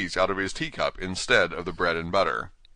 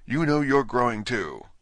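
bold; 5 semitones higher than usual. Acted. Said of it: "said Alice more boldly".

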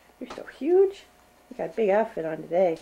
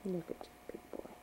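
whisper; 3 semitones lower, 16 dB quieter than usual. Real, not acted. Whispering to baby.

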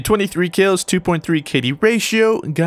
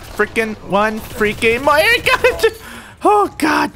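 high, strained exasperation; frantic last-kill pleading; desperate; 12 semitones higher, slower than usual. Real, not acted. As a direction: drag it out, pitched high, under pressure.

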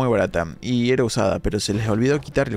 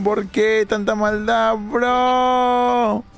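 much higher, slow, near shouting in disbelief; high outraged wail; 10 semitones higher, much slower than usual. Real, not acted. Streamer shouting at the enemy's cruelty, high and pained. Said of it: "Tu voz está rara".

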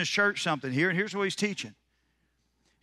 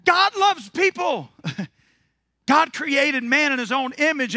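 high, loud, ringing preacher's cadence; preaching at full force; 10 semitones higher, 4 dB louder than usual. Acted. Preacher firing up the congregation, full-force preaching. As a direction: preach it out to the whole room. Preacher building up his sermon.

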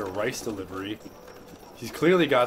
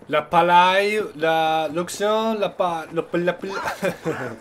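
bad French accent, slow and loud; 5 semitones higher, 5 dB louder, slower than usual. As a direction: butcher a French accent on purpose. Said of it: "goddamn French accent".

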